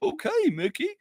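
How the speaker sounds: high cartoon character voice; silly high-pitched cartoon impression; Goofy. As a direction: put on a goofy, squeaky cartoon voice. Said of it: "Go-, Goofy".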